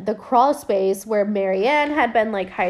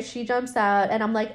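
normal talking voice

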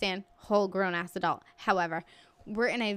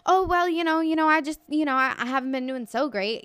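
higher, louder burst of hurried rambling; rapid rambling; 6 semitones higher, 6 dB louder than usual. Retold or imitated. Friend answering mom's how-are-you, rapid rambling. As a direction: imitate a man babbling out excuses. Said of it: "He's talking this fast the entire time".

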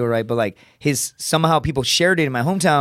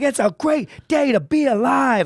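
coach's voice; 9 semitones higher than usual. Retold or imitated. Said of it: "he would say shit like".

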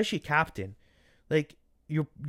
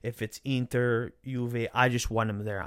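normal talking voice

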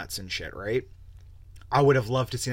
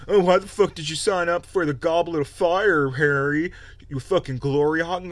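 loud, high accusing whine; mock Ron blaming Harry, a bit louder; whiny Ron; 5 semitones higher, 6 dB louder than usual. Ron accusing Harry over the Goblet, loud mock whine. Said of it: "Ron's like".

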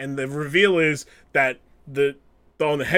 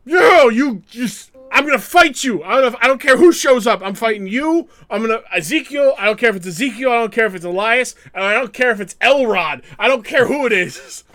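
fired-up threat; 5 semitones higher, 4 dB louder, faster than usual. Retold or imitated. Kevin Owens challenging anyone backstage, fired-up yell. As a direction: hype him into a fight. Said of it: "he's like".

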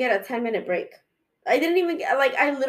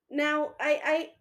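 ordinary delivery

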